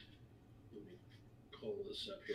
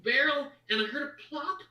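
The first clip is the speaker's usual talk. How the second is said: put-on high little boy voice, rushing; nervous child; 12 semitones higher, faster than usual. The halfling boy confessing to Bertha where he found the necklace, high and nervous. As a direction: explain it quickly, afraid of blame.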